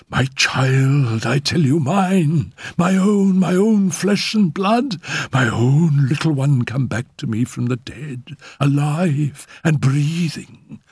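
high, intense, possessive outpouring; impassioned raving. A narrator's reading. Mother claims her child from the dead, impassioned. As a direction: build to a high pitch, then stretch the ending.

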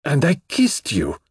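high, slow lament; mortified, drawn-out cry; distressed. A narrator's reading. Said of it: "cried with a deep accent of distress".

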